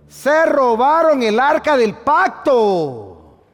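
high, loud, slow, drawn-out announcement; preacher revealing the ark was stolen; dramatic proclamation. Real, not acted. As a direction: land the shock line like a blow. Dramatic climax of the story.

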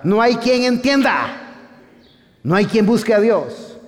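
fiery preaching. Acted. Preacher reading Romans to the church, fervent. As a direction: preach it out to the congregation.

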